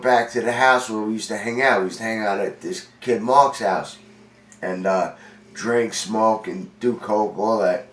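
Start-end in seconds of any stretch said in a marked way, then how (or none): none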